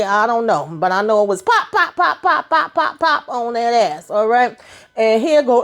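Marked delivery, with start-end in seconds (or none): 1.7-4.1 high, punchy pop pop gunshot sounds; imitated gunshots. Narrator mimicking the shots in a high voice. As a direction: spit out the pops like shots.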